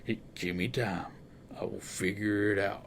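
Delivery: low, soft cowboy rumble; Sam Elliott voice. Retold or imitated. Detective asks for time, in a low Sam Elliott voice. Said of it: "let me do a Sam Elliott voice".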